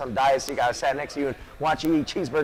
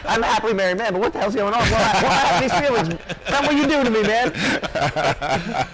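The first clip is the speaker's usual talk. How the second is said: half-joking disbelief, pitch climbing higher toward the end; mock bewildered inner voice; 6 semitones higher than usual. Commentator jokingly acting out his own baffled thoughts, mock bewildered. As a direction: play up your own confused thoughts with a grin. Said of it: "I'm, like, sitting there going".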